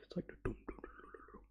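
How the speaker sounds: whispered imitation of soft drums; whispered dum dums. Vlogger imitating the soft jungle music, in a whisper. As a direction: breathe the dums out very softly.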